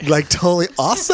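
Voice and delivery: put-on bubbly Valley girl voice. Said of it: "do you talk like Valley talk?".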